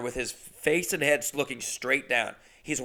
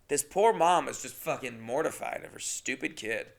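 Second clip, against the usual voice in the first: normal talking voice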